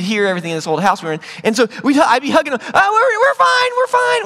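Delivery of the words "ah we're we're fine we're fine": high, loud mock-innocent yell. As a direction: yell it hastily up to a parent. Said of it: "We're yelling".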